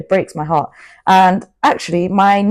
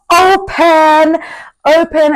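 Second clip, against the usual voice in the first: high, loud, drawn-out sung-out call; emphatic proclamation; 9 semitones higher, 5 dB louder, slower than usual. Pretend. Motivator urging viewers to be open, loud proclamation. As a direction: belt it out like a rallying cry.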